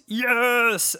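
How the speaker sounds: high, loud drawn-out cheer; elated cheer. Real, not acted. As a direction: celebrate a sudden win. Delighted at learning about HBO.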